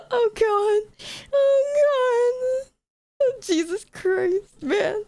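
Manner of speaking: high, slow, teary laughter in the words; laugh-crying. Real, not acted. Streamer embarrassed before chat, high half-laughing groans. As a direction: breathe out the oh-gods between laughs.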